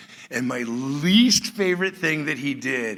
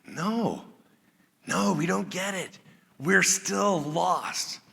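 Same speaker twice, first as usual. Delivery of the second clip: confused disciple owning up; sheepish confusion; 5 semitones higher, slower than usual. Pretend. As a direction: mumble it like a lost student. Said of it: "the answer is probably".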